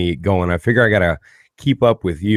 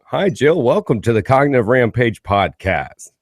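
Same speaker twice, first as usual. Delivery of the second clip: high, exaggerated podcast-host greeting; mock radio guy; 3 semitones higher than usual. Host imitates his own greeting to Jill, mock radio voice. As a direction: send up a cheesy radio announcer. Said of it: "I heard myself at the end".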